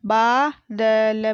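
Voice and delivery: बादल drawn out as baa, da, la; very slow बादल, each letter held apart; spelled out. Shown as a demonstration. Teacher sounding out बादल for pupils, syllable by syllable. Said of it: "मैंने इस तरीके से पढ़ा".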